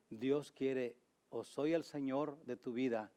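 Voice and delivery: normal talking voice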